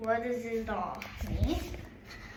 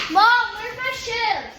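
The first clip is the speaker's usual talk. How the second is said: high, loud call to mom; yelling across the room; 11 semitones higher, 7 dB louder than usual. Real, not acted. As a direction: shout it to another room. Calling out to mom.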